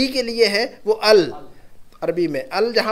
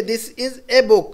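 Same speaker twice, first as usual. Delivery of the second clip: slow, with "a" picked out; stress on "a"; much slower than usual. Shown as a demonstration. Teacher matching English to Arabic, slowly stressing "a". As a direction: point up the article "a" before book. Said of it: "तो ए जो कह रहे हैं ना".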